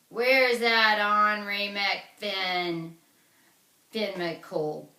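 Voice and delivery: loud, slow booming call at the door; giant bellowing. Acted. Giant at the door demanding Finn, slow and booming. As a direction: boom it out like a giant hunting his enemy.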